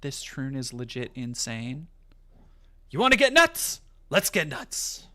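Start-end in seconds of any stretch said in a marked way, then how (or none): 2.9-5.0 high, loud yelled challenge; shouting. Pretend. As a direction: belt it out. Batman movie quote, yelled.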